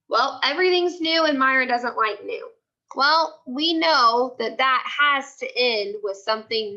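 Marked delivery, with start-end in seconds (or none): none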